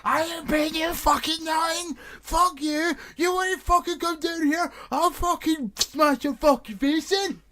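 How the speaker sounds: high-pitched mock furious rant; going absolutely berserk. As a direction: rage like a prank victim losing it. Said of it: "you just hear them go absolutely fucking berserk".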